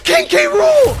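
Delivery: very high, loud scream of the name; wild high yell of joy; ecstatic shout. Real, not acted. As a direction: scream it like a fan whose wish came true. Yells wished-for character's name.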